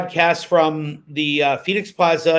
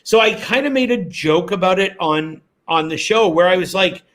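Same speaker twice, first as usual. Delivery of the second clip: ordinary talk, nothing marked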